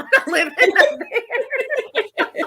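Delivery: loud, high, laughing protest; laughing refusal. Real, not acted. Joking to co-host about monster-ridden Alaska, laughing. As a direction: crack up while refusing outright.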